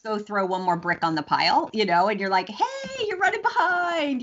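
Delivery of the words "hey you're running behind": high, squeaky mock nag; mocking nagging coworker. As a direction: mimic a prissy coworker, much higher.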